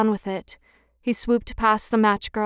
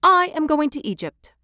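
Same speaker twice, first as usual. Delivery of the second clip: higher-pitched announcement; lifted, mildly excited cry; swallow's cry; 5 semitones higher than usual. A narrator's reading. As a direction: call it out eagerly as a little bird. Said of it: "cried the swallow".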